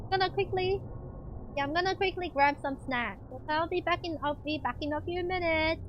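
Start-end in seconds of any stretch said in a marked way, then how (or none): none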